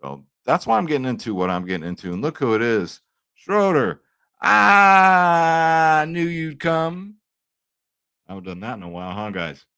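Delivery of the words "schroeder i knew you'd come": high, loud, drawn-out villain croon; slow, eerie wrestling-villain call; broken Matt Hardy impression. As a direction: stretch it out like an unhinged wrestler greeting an old friend. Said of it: "That's my broken Matt Hardy impression".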